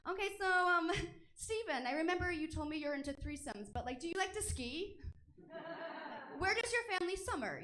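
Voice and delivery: high-pitched, hesitant first-date chat; awkward small talk. Acted. Meeting a sexting partner in person, high awkward politeness. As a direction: act normal with a nervous little laugh. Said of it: "it's kind of awkward to do it".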